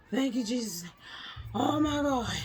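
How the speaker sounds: high, wailed thanks to heaven; overwhelmed relief. Retold or imitated. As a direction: imitate collapsing in prayerful relief. Acting out relief after painful procedure.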